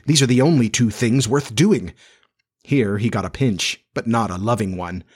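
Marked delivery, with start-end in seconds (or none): none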